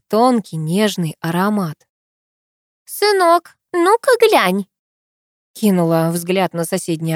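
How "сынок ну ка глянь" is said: put-on high old woman's voice calling out. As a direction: call to your son in a high, old voice. Old woman calls to son.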